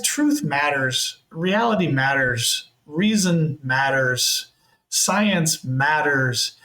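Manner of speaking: slow, spaced-out declarations; deliberate, drawn-out insistence; emphatic plea. Real, not acted. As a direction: land each short statement separately, slowly.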